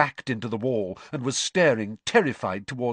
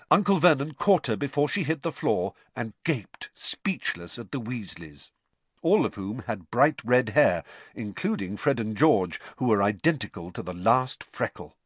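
ordinary delivery

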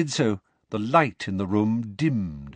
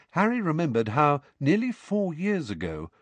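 plain reading voice

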